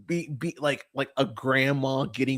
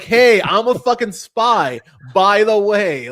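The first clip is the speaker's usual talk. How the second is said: louder and higher, a hammed-up blurt; mock loud confession; 7 semitones higher, 8 dB louder than usual. Ranting at the spy friend in the film, mock loud blurt. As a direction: blurt the confession like an exasperated shout.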